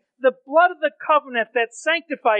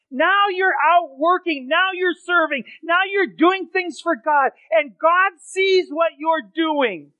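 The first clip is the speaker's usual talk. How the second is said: rising to a shout; building fervor; 3 semitones higher, slower than usual. Acted. Preacher builds to a shout praising believers' works.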